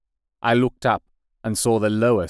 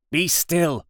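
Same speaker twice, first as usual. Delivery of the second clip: urgent imploring; 9 semitones higher than usual. A narrator's reading. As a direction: implore him to stop. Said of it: "I implored".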